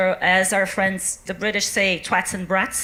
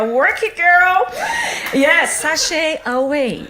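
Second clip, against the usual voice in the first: mock drag queen sass; 4 semitones higher than usual. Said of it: "obsessed with drag queens".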